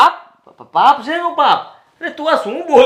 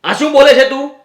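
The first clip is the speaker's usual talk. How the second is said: raised, higher voice, snapping back at her; angry outburst; 4 semitones higher, 5 dB louder than usual. Acted. Husband yelling at Meera over her accusation, angry. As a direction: shout her down in disbelief.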